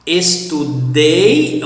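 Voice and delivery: "Estudei" slowly, with a loud, drawn-out final "dei"; stress on last syllable. Shown as a demonstration. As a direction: land hard on the "dei" of "Estudei". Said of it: "stress on the last syllable".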